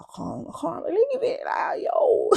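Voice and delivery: high, loud crying shout; crying out at big sister; anguished cry. Retold or imitated. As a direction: wail it out in disbelief. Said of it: "'cause I was just like".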